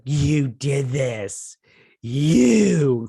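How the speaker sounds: hammed-up, drawn-out loud accusation; exaggerated mock accusation. Woman blaming someone at the hospital, melodramatically accusing. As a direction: stretch each word, swelling to a loud wailed YOU. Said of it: "She doesn't say it like that".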